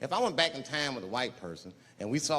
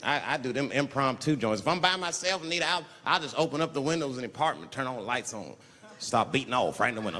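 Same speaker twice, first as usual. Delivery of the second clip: ordinary talk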